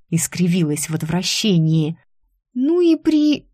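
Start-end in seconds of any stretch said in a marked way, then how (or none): 2.5-3.5 high, disgusted exclamation broken off; cut short. A narrator's reading. Secretary catching herself under boss's warning look, cut short. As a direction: blurt it, then stop mid-word. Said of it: "вовремя смолкла".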